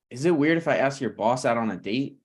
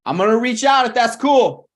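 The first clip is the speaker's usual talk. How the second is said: calling out; 10 semitones higher, 8 dB louder than usual. Acted. Ryan calling after Thomas as he leaves, loud call. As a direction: call out loud and higher after someone leaving. Calls after departing friend.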